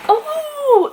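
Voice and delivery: high squeal of delight; delighted gasp. Real, not acted. Surprised by a gift, high squeal. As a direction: gasp at the present.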